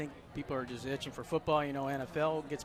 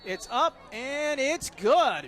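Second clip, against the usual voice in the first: rising to a loud, high call; excited kick call; 7 semitones higher, 6 dB louder, slower than usual. Real, not acted. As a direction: build up to the call as it goes through. Calling the kick live.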